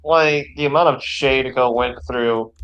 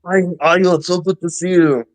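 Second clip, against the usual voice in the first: Arnie voice; 4 semitones higher than usual. Pretend. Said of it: "I can actually do the impression".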